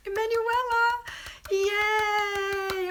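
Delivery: high, loud, delighted squeal of a name; gleeful high-pitched yay; excited cheer. Real, not acted. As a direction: cheer her on at the top of your voice. Cheering a viewer's news.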